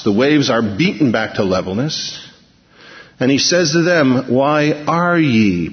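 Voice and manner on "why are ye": "are" stretched out very slowly; stress on present tense. Shown as a demonstration. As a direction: hold the word "are" to mark it. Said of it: "present tense".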